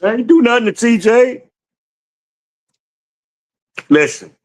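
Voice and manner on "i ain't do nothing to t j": squeaky high retold protest; mock outraged protest. Retelling his mix-up to chat, squeaky mock protest. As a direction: play up his own panic as a squeaky cry. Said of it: "I'm like".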